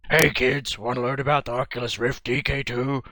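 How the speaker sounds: weak attempt at a shark voice; a hint of a friendly shark host. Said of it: "I wish I had a good shark voice".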